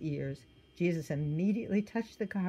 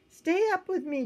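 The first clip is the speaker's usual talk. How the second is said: pleading Jesus; 13 semitones higher, 5 dB louder than usual. A narrator's reading.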